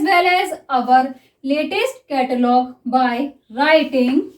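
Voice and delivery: very slow, even dictation reading. Shown as a demonstration. Teacher reads a shorthand passage to students, very slowly. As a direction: read it out word by word for writing.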